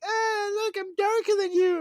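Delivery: mock girly squeal, very high-pitched; imitating girlfriend's boast. Boyfriend mimicking his tanned girlfriend, mock high-pitched gloating. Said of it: "She'd be like".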